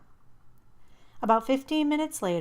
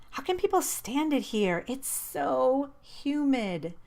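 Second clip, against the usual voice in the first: higher, drawn-out complaint; whining; 6 semitones higher, slower than usual. A narrator's reading. Complaining to herself about humidity, whiny and slow. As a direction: whine about the heat, slow.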